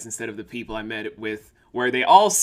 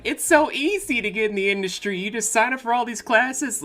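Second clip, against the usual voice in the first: high-pitched, breezy mimicry; mocking chirpy newcomer; 11 semitones higher than usual. Newcomers telling a veteran it's easy, mimicked in a high voice. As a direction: say it high, as if it's all effortless.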